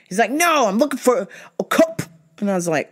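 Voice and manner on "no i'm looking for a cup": high, insistent silly guy; high goofy customer voice. Retold or imitated. Bald customer voiced in story.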